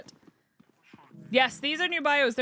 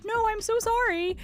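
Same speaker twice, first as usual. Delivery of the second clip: put-on apologetic no to a child; 11 semitones higher than usual. Narrator refusing the toddler, sorry. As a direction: let the toddler down gently. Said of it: "as soon as I said".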